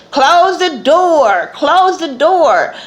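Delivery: lightly voiced Portia, mimicked by [name]; mimicking Portia. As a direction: drag it out like you're imitating her. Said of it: "[name] keeps saying that Portia said".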